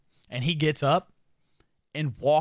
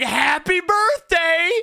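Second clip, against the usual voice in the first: high, loud, drawn-out birthday holler; mock celebratory shout; 9 dB louder, much slower than usual. Chefs come out with a giant cake, imitated celebratory shout. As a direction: holler it slowly like a surprise party crew.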